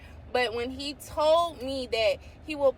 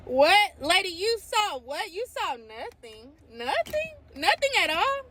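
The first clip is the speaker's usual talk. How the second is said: high, loud incredulous what; can't believe what she heard; amazed disbelief; 7 semitones higher, 5 dB louder than usual. Real, not acted. Amazed by the background check.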